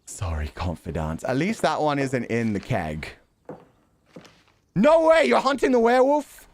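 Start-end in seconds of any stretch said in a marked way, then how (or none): 4.7-6.5 loud, high disbelieving cry; shocked innkeeper. Acted. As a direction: blurt it out in loud astonishment.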